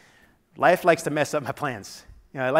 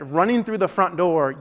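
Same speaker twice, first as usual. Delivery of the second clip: ordinary talk, nothing marked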